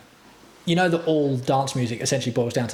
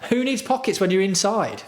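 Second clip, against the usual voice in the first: higher sarcastic repeat of the line; mocking voice; 7 semitones higher than usual. Streamer repeating his jersey joke, higher mocking voice. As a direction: savour the joke in a raised mock tone.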